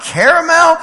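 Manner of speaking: CARAMEL as CARE-a-mel, high and questioning; dad's pronunciation. Retold or imitated. Dad checking the word with his kids, high CARE-a-mel. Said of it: "that's not how you say it".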